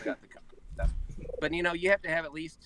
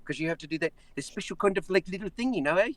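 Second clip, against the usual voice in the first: South African accent; 5 semitones higher, 5 dB louder than usual. Shown as a demonstration. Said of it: "that's really hard to do".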